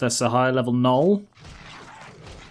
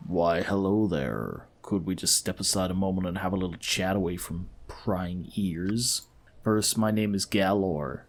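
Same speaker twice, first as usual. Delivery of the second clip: sly, scheming partner; 5 semitones lower than usual. Acted. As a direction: draw them aside and propose a crooked deal. Voices scheming partner Galor.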